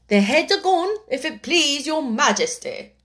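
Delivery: high, loud military report; shouted reply. A narrator's reading. The soldiers report back to the Queen, shouting. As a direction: call it out to royalty. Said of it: "the soldiers shouted in reply".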